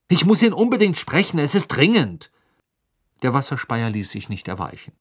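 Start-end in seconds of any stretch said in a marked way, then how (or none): 0.1-2.3 high, loud, insistent plea; angry urgency. A narrator's reading. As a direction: insist furiously that it cannot wait. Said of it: "sagte er wütend".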